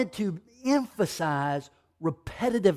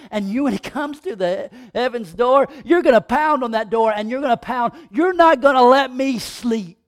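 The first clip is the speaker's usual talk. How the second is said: loud, raised, insistent build; full-force preaching; 4 semitones higher, 7 dB louder than usual. Acted. Preacher as God urging listeners to keep asking, loud and driving. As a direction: pound out each repeated phrase, building to a loud peak.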